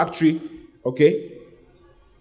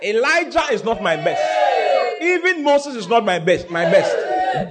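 preaching shout; 7 semitones higher, slower than usual. Acted. Preacher voicing God to the congregation, shouting. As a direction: cry it out to the crowd.

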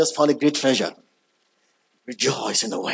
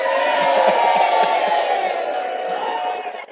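shrieking laugh. Real, not acted. Laughing at the Korean drama joke.

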